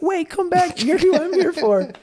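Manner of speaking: high, loud call after her; yelled out. Retold or imitated. To the girl running away, calling out loud. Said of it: "I yelled out".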